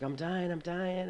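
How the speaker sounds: high, drawn-out mock yell; slow, high-pitched cry for rescue; retold frantic yelling. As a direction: imitate your brother panicking, without full volume. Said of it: "he was yelling".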